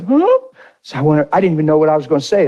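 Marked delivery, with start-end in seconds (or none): 0.0-0.4 high, rising imitated gasp of a question; wife's reaction. Wife asked to renew vows, high mimicked surprise. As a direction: squeak it like an astonished wife. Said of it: "She said".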